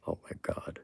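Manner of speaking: whispered exasperation. Real, not acted. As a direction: breathe it out in a whisper. Exasperated aside.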